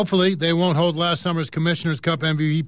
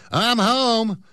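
higher, called-out greeting; calling out; 5 semitones higher than usual. Acted. Jeremy calling to parents as he enters, higher called greeting. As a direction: call it into the house. Teen calls out coming home.

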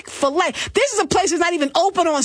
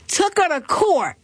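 usual speaking voice

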